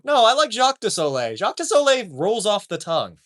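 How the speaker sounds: ordinary talk, nothing marked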